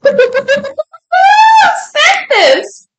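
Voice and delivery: real high squealing laugh-cry; helpless laughter stretching out the words. Real, not acted. Opening a surprise package with no sender named, laughing.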